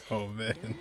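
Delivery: normal talking voice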